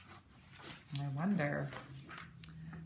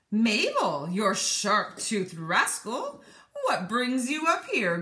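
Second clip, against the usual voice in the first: cheerful seagull; 2 semitones higher, 4 dB louder, slower than usual. Acted. Seagull greets old friend.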